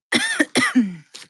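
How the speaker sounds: loud dusty cough; a real cough from the dust. Real, not acted. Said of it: "the dust is getting me".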